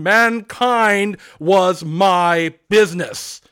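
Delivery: loud, slow, grieving ghost; unearthly voice. Acted. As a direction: proclaim like a tormented spirit. Ghost declares, wringing hands.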